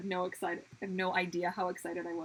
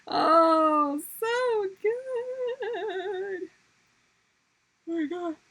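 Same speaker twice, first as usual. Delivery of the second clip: delighted; 9 semitones higher, 8 dB louder, much slower than usual. Real, not acted. Reacting to music.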